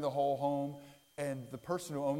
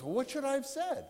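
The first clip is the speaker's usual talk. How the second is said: puzzled husband; 10 semitones higher than usual. Retold or imitated. Husband asks the wife.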